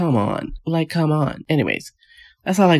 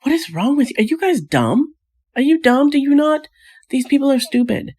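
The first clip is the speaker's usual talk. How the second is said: incredulous outburst; 12 semitones higher than usual. Retold or imitated. Vlogger yells at the couple, high and exasperated. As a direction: yell at the screen in disbelief. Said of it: "I'm just like".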